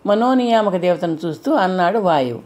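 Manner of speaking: usual speaking voice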